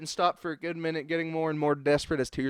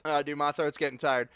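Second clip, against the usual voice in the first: usual speaking voice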